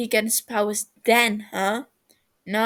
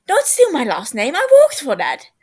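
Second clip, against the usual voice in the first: rising into a high, loud squeal of outrage; indignant protest; 7 semitones higher, 11 dB louder than usual. Acted. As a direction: wail it like your name is being stolen. Tubbo protests stolen name.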